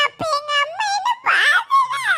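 high wail breaking into sobs; crying. Acted. Child telling friend that mother saw, crying. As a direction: let the last word dissolve into crying. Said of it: "யாமட்டி ஊதா அழுக?".

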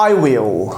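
I will said slowly and clearly as two words; teacher sounding out "I will"; model pronunciation. Shown as a demonstration. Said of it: "nasıl telaffuz edildiği".

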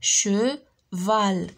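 "Cheval" split into che and val with a gap; syllable by syllable. Shown as a demonstration. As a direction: say "Cheval" in two drawn-out beats. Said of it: "في لا دوزيام سيلاب".

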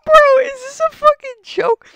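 loud, very high squeaky disbelief; helpless high squeal. Real, not acted. Streamer losing it at a monkey's name, squealing disbelief. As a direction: squeal it, cracking up.

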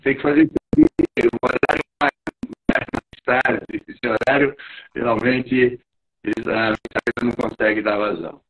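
breaking up over a bad call line; choppy connection. Real, not acted. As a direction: voice dropping out, garbled online audio. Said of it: "Tá picotando".

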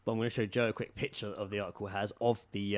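usual speaking voice

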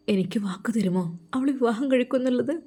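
a wife pleading softly in her husband's ear; hushed whisper. Acted. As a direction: lean in and whisper the question. Intimate plea to the husband.

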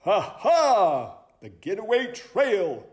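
high, lively sleuth voice; excited high-pitched owl sleuth; eager owl detective. Acted. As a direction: announce it like a keen detective.

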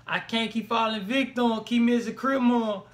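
slow, drawn-out Florida drawl; exaggerated Florida accent, dragged out; imitated Florida rapper. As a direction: mimic a Florida rapper, stretching the words.

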